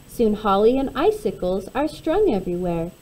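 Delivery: plain reading voice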